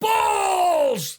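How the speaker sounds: high, drawn-out joke deathcore shout; mock metal yell. Acting out a joke deathcore breakdown call, mock yell. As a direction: bellow it high like a breakdown cue. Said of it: "someone should do that".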